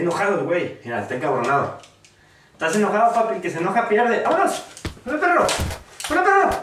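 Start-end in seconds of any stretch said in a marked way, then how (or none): 4.2-6.5 hyped shout. Real, not acted. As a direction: yell it to taunt the opponent. Yelling greeting at opponent.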